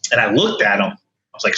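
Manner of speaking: ordinary talk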